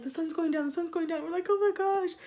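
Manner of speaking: high, rising mock alarm; retold panic. Storyteller replays being stuck as the sun sets, mock panic. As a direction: act out mounting panic, pitched high.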